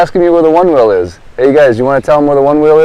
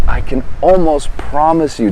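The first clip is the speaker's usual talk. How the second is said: normal talking voice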